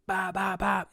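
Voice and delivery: high, blaring alarm noise; high-pitched mock alarm beeps; imitated alarm. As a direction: mimic a burglar alarm in repeated bursts. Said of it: "the fucking alarm starts going off".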